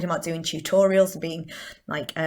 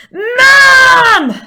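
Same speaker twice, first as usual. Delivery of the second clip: very loud, high "mam" yell; child's shout for mum; 18 dB louder than usual. Acted. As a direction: yell for Mum like a Southwest kid. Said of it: "take on their voice".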